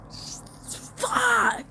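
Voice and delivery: long, high-pitched expletive; exasperated yell. Acted. Telling people who got called ugly to move on, exasperated yell. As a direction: let one word rip, drawn out and high.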